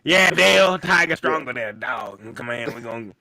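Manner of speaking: lightly hammed-up Southern coach accent; teasing imitation of a put-on Southern accent; Brian Kelly fake accent. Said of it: "that foreign accent from Waterboy".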